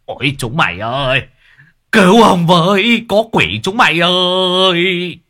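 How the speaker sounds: high, loud, dragging cry for help; panicked yell. Acted. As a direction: yell in terror, drawing out the end. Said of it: "hét toáng lên".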